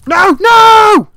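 high, loud screams of no; terrified screams. Real, not acted. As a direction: scream as you're hit. Killed by ghast again.

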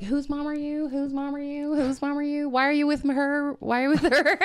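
high, insistent imitated children; high-pitched mock kid questioning, ending laughing; curious kids. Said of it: "it's genuine, you know, curiosity".

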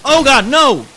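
loud, high wailing dismay; high-pitched panicked shouting; real panic. Real, not acted. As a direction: yell in horror at an intruder. Another player invades the game.

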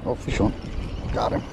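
ordinary talk

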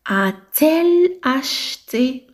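ا تيل اشتي said very slowly with the t in تيل sounded; teacher modelling the inversion slowly; slow model question. Shown as a demonstration. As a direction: dictate the inverted question word by word. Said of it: "ما نقولوش ا ال اشتي".

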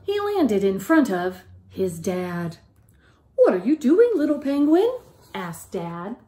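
lively, sing-song picture-book reading; reading to children. A narrator's reading. Teacher reading a picture book aloud to the class, lively and sing-song. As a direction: tell it like a bedtime story to little ones.

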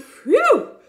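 loud high relief; relieved phew. Acted. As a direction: blow it out in relief. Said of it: "said the dog".

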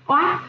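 Oát read as one smooth syllable. Shown as a demonstration. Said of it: "Cô đọc trơn".

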